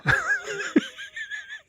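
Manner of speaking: high squealing burst of laughter. Real, not acted. Streamer cracks up at her own ASMR try, squealing laugh. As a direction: break up laughing, high and giggly.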